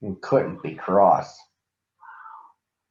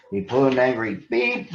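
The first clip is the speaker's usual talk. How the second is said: ordinary delivery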